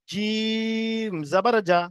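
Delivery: each syllable held long and even; teacher stretching the letter for the class; drawn-out recitation. Shown as a demonstration. Teacher shows students the long drawn Quran recitation. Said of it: "জিম প্রথমে টান দিয়ে দেবো".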